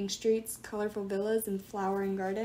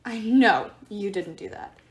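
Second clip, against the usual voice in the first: slow, sassy disbelief; mock offended; 6 dB louder than usual. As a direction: put some attitude on it, slowly.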